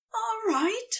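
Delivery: high, breathless question. Acted. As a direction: ask it short of breath. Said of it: "Aziraphale asked, breathless".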